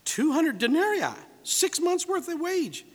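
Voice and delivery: high, disbelieving tally; high-pitched acted dismay at the cost; acted incredulity. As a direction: draw out the sum as if it's absurd. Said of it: "He says, uh".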